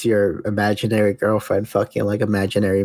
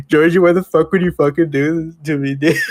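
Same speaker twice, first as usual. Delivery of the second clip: raised, higher mock outcry; mock scream; 7 semitones higher, 4 dB louder than usual. Guy confronts imaginary friend Georgie, mock scream. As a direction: act out a hammed-up betrayed yell. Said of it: "he starts screaming out".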